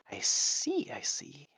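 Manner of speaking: put-on whispered lizard accent, high then fading. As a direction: hiss it softly like a scheming lizard. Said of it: "doing a lizard accent".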